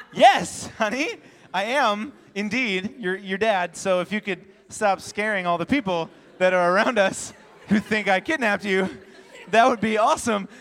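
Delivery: loud, strained mock patience; over-polite dad barely keeping his cool; exasperated dad. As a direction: answer loudly, embarrassed by your kid. Embarrassed dad answering daughter in public.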